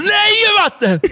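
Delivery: very high-pitched mock tantrum shriek; screaming child. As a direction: imitate a kid shrieking a refusal. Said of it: "och bara skriker".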